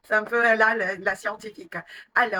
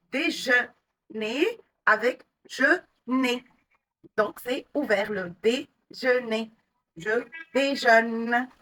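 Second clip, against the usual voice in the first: slow contrast demo; slower than usual. Shown as a demonstration. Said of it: "on ne doit pas confondre".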